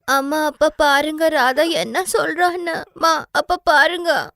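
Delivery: put-on child crying to parents about her sister's taunts; crying complaint. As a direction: whine through tears to mum and dad. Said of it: "Sudha எப்பவுமே அழுவா".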